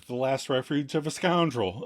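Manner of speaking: normal talking voice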